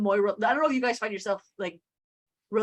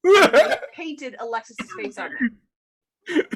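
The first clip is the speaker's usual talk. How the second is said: laughing; 6 semitones higher, 8 dB louder, much slower than usual. Real, not acted. Laughing at a funny show moment.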